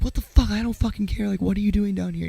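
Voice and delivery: high, loud mock rage; angry outburst. Stranger confronting dad on the road, angry shouting. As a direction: imitate a furious stranger cutting someone off.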